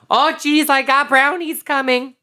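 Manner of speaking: high falsetto mom voice; hammed-up high homemaker; housewife mom. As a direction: fret over the baking in a squeaky housewife voice. Plays the mom villain again.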